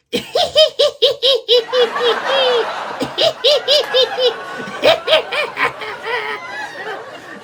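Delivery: high, loud cackling fit; imitated helpless laughter. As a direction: burst into a long mock cackle. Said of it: "is just busting out laughing".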